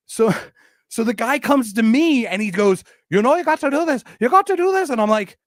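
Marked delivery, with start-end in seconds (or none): 3.1-4.9 high-pitched mock Irish accent; Irish bloke. Irish fan telling the speaker what to do, in a high mock Irish accent. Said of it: "I can only do a high pitched one".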